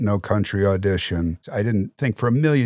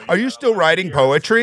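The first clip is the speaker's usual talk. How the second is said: high mock voice; 12 semitones higher, 5 dB louder than usual. Imitating small talk with Ethan.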